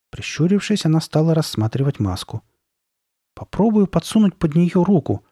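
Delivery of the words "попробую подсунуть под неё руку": higher, pondering tone; thinking aloud. A narrator's reading. Sabrina working out how to remove mask, thinking aloud. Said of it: "размышляла она вслух".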